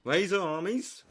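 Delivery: rallying call. Shown as a demonstration. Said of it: "I need a voice crack there".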